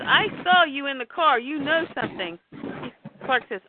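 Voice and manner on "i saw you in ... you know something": higher-pitched acted challenge; heated accusation. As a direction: confront a friend who is holding back. Pete accuses Clark.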